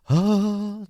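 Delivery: high, drawn-out "oh" of wonder; breathless mock awe; awestruck gasp. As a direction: gasp as if an angel appeared. Retold awestruck first sight of idol.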